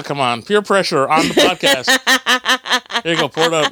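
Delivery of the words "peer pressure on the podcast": slow laughing singsong; laughing tease. Real, not acted. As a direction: drag it out through a laugh.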